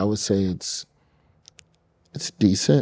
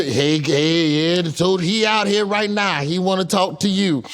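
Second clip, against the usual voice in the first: higher, hurried mock phone call; mock panicked friend; 10 semitones higher than usual. Friend phoning for money as tow truck waits, high mock voice. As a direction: play the friend calling in a panic, pitched up.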